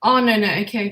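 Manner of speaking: ordinary delivery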